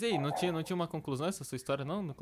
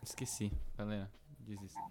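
trailing off; 6 semitones lower, 10 dB quieter than usual. Real, not acted. Gives up on forgotten point.

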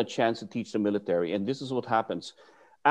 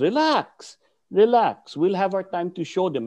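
high, raised-voice relax; mock calming shout; 6 semitones higher, 4 dB louder than usual. Guru Jun reining in his eager student, high exasperated calls. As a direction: call out to rein in a hothead. Teacher retold reining in Doug.

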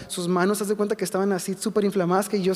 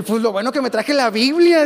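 louder, punched-up retold remark; emphatic exclamation; 3 semitones higher, 7 dB louder than usual. Young minister telling himself he came prepared, emphatic exclamation. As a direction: blurt it out loud for comic effect.

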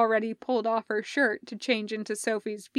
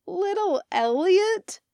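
incredulous question; 9 semitones higher, 4 dB louder than usual. Acted. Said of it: "both Elliot and Hardison asked".

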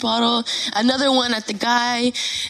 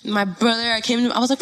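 usual speaking voice

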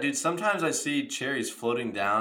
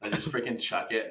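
ordinary talk, nothing marked

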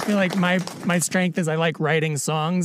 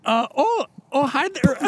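high-pitched Minnesota-nice greeting; hammed-up Midwest accent, pitched up; 7 semitones higher than usual. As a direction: put on a chipper Midwestern accent. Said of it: "like, a Midwest".

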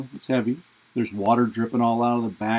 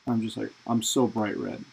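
normal talking voice

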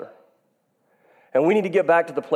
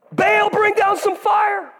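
mock prophet's cry; slower than usual. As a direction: cry out to Baal like a frantic prophet. Prophets of Baal crying out.

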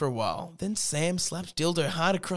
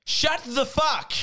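high, slow, drawn-out mock yell of shut the fuck; mock angry shout; 10 semitones higher, 3 dB louder, much slower than usual. A fifth year yells at the boys in a slow, high mock yell. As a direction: stretch it out in a hammed-up high cry. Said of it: "said a fifth year".

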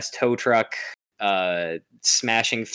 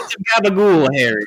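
much higher, louder hammy New York Italian; mock New York Italian; 9 semitones higher, 9 dB louder than usual. Joking that Peter sounds New York Italian, loud and hammy. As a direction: ham up a Jersey Italian accent. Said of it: "obnoxiously New York".

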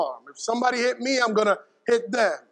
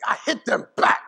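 a child's firm answer, lightly voiced; emphatic child; 3 semitones higher than usual. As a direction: say it like a proud little boy. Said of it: "very emphatically".

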